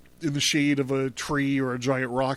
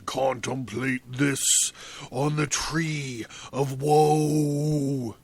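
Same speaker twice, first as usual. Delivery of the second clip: slow, grandly drawn-out pronouncement; hammed-up doom-laden villain voice; mock-solemn villain; slower than usual. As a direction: intone it ominously, stretching the last word. Mock-solemn villain line amid reading.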